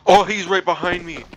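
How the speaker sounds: real fright from the jump scare; panicking gamer. Real, not acted. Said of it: "now I'm actually scared".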